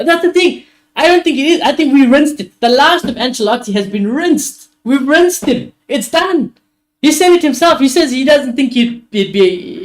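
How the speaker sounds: insistent, high and loud outburst; worked-up rant. Real, not acted. Worked-up rant that manager is finished.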